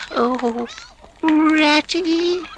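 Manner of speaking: very high anxious wail; despairing cry. A narrator's reading. Mole to Rat, very high despairing cry. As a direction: Mole panics to his friend. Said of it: "he cried in despair".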